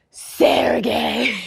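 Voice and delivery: drawn-out stage whisper of the name; hammed-up breath of recognition; whispered realisation. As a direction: whisper it in mock dismay. Retold dawning realisation about guest.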